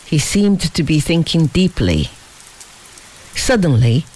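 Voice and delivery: plain reading voice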